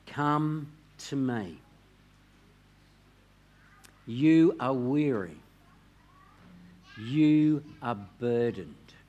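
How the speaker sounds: slow, hushed, fading to near silence on rest; tender scripture voice. Shown as a demonstration. Preacher voices Jesus to weary listeners, slow and hushed.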